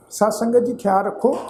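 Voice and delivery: ordinary delivery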